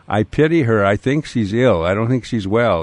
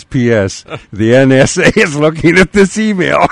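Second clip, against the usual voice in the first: laughing; 7 semitones higher, 7 dB louder than usual. Retold or imitated. Reads listener's joke punchline.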